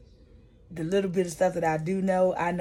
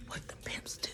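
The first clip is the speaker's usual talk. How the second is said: whispered aside; 15 dB quieter than usual. Real, not acted. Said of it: "aside explaining pimps".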